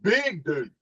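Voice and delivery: usual speaking voice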